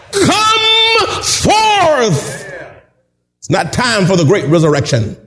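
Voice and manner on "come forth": much higher, drawn-out shouted command; booming call. Retold or imitated. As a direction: raise the dead with it. Jesus calls Lazarus from tomb.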